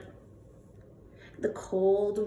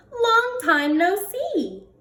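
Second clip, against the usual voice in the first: hare greeting; 9 semitones higher than usual. Acted. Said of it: "says Hare".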